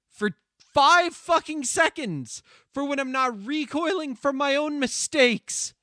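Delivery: retold exasperated complaint to a friend; exasperated plea. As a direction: beg her to back off, building in frustration. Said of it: "And I'm like".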